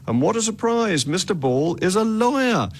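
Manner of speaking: sarcastic. Real, not acted. Host quips to listeners about Mister Ball, sarcastically. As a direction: feign surprise with dry sarcasm. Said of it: "you say rather sarcastically".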